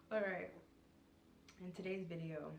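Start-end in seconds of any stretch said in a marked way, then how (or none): none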